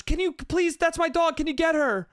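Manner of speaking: high, pleading call for help; mock yell. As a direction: call out urgently, imitating a desperate owner. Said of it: "[name] just yells out".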